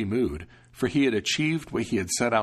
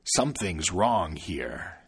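lower, menacing devil; sniffing devil; 3 semitones lower, slower than usual. Acted. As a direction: sniff out the human, suspicious. Devil suspicious.

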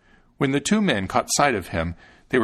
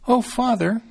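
put-on very high little-boy voice; Thumbling crying out; 12 semitones higher, 4 dB louder than usual. A narrator's reading. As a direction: call out eagerly as a tiny fellow. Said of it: "cried Thumbling".

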